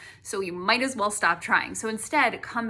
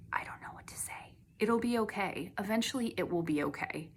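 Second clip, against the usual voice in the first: hushed reassurance; 7 dB quieter than usual. Acted. Comforting a viewer who just filed bankruptcy, whispered. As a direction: drop to a soft whisper of comfort.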